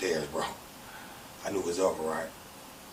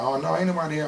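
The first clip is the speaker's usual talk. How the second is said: higher-pitched imitation of a woman covering; mock-innocent, raised pitch; imitating innocent denial; 7 semitones higher than usual. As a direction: lightly act out an innocent denial. Marcy covers for him to police.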